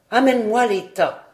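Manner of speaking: a loud commanding call; shouting. A narrator's reading. Aunt demanding the cloud heaps, shouting. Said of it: "criait encore dame Colette".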